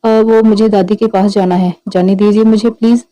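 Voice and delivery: ordinary delivery, nothing marked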